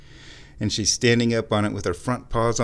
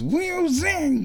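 excited squeal. Real, not acted. Cat zooming out of the room.

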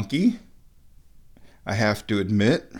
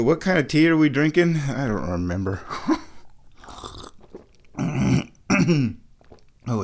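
ordinary talk, nothing marked

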